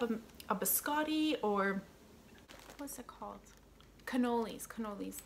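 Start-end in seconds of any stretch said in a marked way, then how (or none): none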